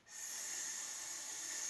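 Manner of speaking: S hissed like the serpent; a voiceless sss, soft; snake hiss. Shown as a demonstration. Teacher asks which letter hisses like a snake. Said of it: "la lettre qui fait".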